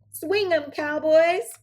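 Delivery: high, loud cowgirl shout; cried out. Acted. Cindy shouts to the cowboys across the dance, high and loud. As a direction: whoop it across the dance floor. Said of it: "cried Cindy".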